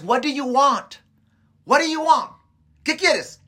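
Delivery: high, forceful question to the audience; emphatic challenge. Real, not acted. Preacher puts the question to listeners.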